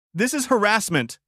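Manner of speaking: high, loud cracking shriek. A narrator's reading. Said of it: "she shrieked, her voice cracking".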